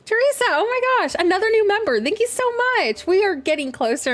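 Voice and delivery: high, fast, delighted squeal of thanks; excited thanks. Real, not acted. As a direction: gush over the new member. Thanking new member on stream.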